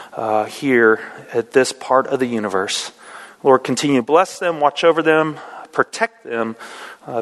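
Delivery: low, reverent prayer voice. Acted. Pastor praying over the congregation, reverent. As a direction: pray it humbly and steadily. Opening prayer before sermon.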